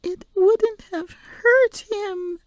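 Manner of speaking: put-on high, slow crying child; stubborn tearful insistence. A narrator's reading. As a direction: dig in through tears. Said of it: "she repeated stubbornly".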